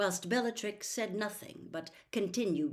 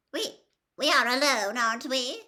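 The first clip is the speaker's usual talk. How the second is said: Narcissa's voice; 6 semitones higher, 8 dB louder than usual. A narrator's reading. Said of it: "Narcissa asked quietly".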